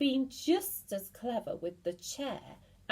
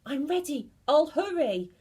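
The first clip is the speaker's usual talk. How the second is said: higher, louder, rushing fast; frantic eager cry; 8 semitones higher, 6 dB louder, faster than usual. Acted. Mrs Twit frantically agreeing to anything her husband says. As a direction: gabble it out in panic. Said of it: "cried Missus Twit".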